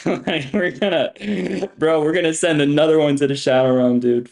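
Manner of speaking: talking through real laughter; laughing. Real, not acted. Streamer banishing another viewer, laughing. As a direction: let the laugh spill into the announcement.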